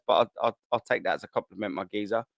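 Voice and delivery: ordinary talk, nothing marked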